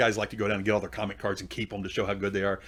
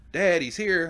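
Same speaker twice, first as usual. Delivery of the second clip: lightly voiced teenage daughter, higher pitch; 7 semitones higher, 4 dB louder, slower than usual. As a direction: call to dad as the daughter, pitched up. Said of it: "and she goes".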